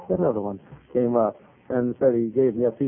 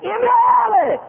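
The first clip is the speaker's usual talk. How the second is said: very high, loud outburst; incredulous mock shriek; 7 dB louder than usual. Lady handed a tract by the garbage cans, outraged shriek. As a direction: shriek it in mimicked disbelief.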